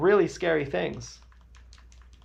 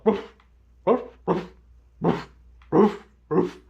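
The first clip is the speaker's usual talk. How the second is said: higher, louder mock barks; Great Dane barking; 4 semitones higher, 4 dB louder than usual. Friends reading a note as Great Danes, loud mock barking. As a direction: bark like a big dog. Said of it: "read it as Great Danes".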